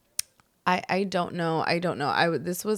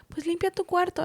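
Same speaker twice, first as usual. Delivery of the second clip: imitated mother; 10 semitones higher than usual. Mother's reply voiced in story.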